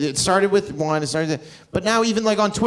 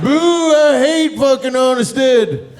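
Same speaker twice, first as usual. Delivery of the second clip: higher, louder slow heckle; mock booing; 7 semitones higher, 5 dB louder, slower than usual. Heckler booing the neighbourhood, mock jeer. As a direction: jeer like an angry local. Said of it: "to boo Bloor and Bathurst".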